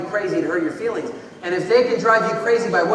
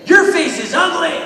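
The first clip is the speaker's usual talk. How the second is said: higher, louder nasty jeer; meaner bully taunt; 10 semitones higher, 10 dB louder than usual. Acted. Bully escalates the insult at the kid, louder taunt. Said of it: "ramp it up and be meaner".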